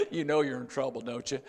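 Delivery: usual speaking voice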